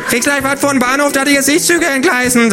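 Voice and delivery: a high, cocky kangaroo. A narrator's reading. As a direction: fire back a cheeky insult. Kangaroo insult contest.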